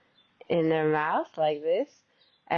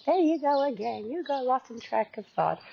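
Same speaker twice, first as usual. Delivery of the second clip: higher-pitched imitation of an inner critic; mock self-criticism; 6 semitones higher than usual. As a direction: lightly imitate a nagging inner voice. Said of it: "negativity or criticism".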